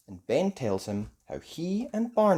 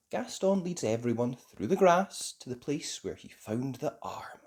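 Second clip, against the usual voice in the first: plain reading voice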